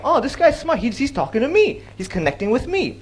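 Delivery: high-pitched delighted realisation; impressed listener. Acted. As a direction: play an audience member feeling noticed. Voices won-over audience member.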